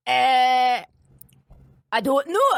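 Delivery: clueless Scottish shopkeeper, drawn-out hesitation; bad Scottish accent. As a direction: imitate a baffled Scottish local. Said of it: "That was a bad accent".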